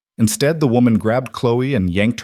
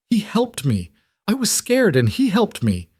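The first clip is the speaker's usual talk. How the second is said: put-on crying child; 12 semitones higher than usual. A narrator's reading. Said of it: "Chloe was crying again".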